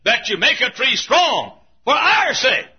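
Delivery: usual speaking voice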